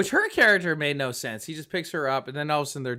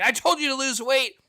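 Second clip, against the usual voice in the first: raised, high-pitched imitation of a scolding; hammed-up exasperated telling-off; mock angry trainer; 6 semitones higher, 3 dB louder than usual. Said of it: "when he's mad".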